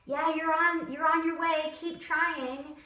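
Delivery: brightly coaxing the dog; encouraging. Shown as a demonstration. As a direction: cheer the dog on. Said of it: "let them know".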